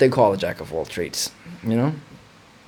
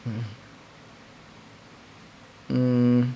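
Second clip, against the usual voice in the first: ordinary talk, nothing marked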